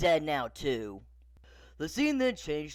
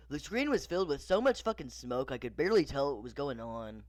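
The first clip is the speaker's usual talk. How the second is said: ordinary delivery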